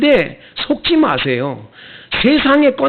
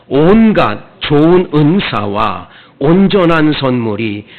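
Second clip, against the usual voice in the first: slow, louder solemn reading of the verse; scripture reading; 3 semitones lower, 5 dB louder, slower than usual. Acted. Pastor leading the congregation's reading of James, slow solemn recitation. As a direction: read the verse out slowly together.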